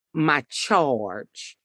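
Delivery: normal talking voice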